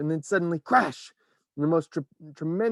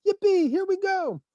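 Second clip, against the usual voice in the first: very high, loud whoop; joyful shout; 5 dB louder than usual. A narrator's reading. Grandpa Joe cheering the ride, loud. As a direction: whoop with glee. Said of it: "shouted Grandpa Joe again".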